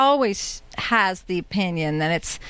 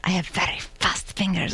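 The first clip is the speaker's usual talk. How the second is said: soft, quiet [name] voice; hint of [name], softly; [name] impression; 2 semitones lower, 4 dB quieter than usual. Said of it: "that was a very good [name]".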